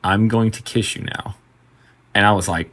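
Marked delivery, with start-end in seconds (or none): 0.0-1.4 slow, measured deadpan quote; dead serious. Retold or imitated. Customer holding on to bartender after a hug, dead serious. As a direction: say it slowly, in dead earnest. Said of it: "dead serious".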